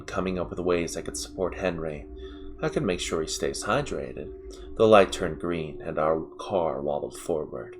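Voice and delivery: usual speaking voice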